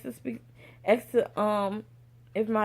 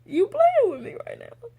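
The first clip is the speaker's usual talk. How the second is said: laughing disbelief; 4 semitones higher than usual. Retold or imitated. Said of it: "I started laughing".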